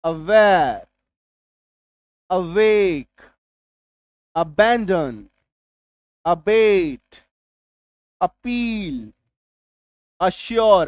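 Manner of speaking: each word said apart, loud and clear; careful teacher's demo; demo English words. Shown as a demonstration. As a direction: model each vowel for the class. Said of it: "जिसमें सिर्फ अ आएगा".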